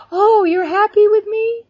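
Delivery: high, drawn-out astonished question; retold amazement, pitched high and loud; delighted surprise. Retells her amazed response to God.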